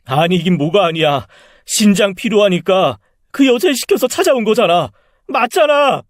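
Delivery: rising angry outburst, climbing high; angry accusation. Acted. Son confronting his mother over a kidney, angry accusation.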